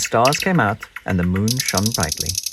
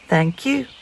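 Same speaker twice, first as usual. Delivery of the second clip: higher, light chirp; chirping bird; 8 semitones higher than usual. A narrator's reading. Friendly bird chirping thanks to Peep. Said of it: "chirped a friendly bird".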